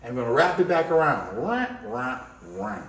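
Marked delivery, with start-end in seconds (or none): 1.4-2.8 soft wah wah sound effect. Shown as a demonstration. Teacher making drawing sound effects, soft wah. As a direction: make the wah noise as you draw. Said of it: "make that sound effect".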